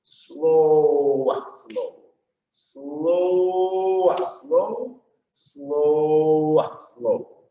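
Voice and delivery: long SLOW, lighter "а, slow"; drawn-out count. Shown as a demonstration. Dance teacher leading the step in time, drawn-out. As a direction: sustain the first SLOW, let "а, slow" drop away. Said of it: "Now we go slow".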